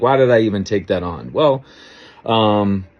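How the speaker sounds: ordinary talk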